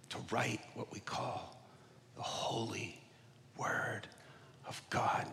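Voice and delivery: quiet, spaced words; hushed, deliberate, word by word; slow emphasis. Acted. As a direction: lay each word down slowly. Slow emphatic phrase, dropping away.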